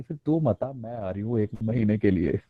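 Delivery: low, teasing mother-in-law; the mother-in-law announcing her visit, low. Retold or imitated. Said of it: "कहती". (सास की धीमी चिढ़ाती आवाज़)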